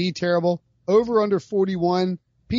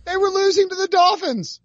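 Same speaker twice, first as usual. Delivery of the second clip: exasperated rant; 13 semitones higher, 3 dB louder than usual. Real, not acted. Ranting about hated team.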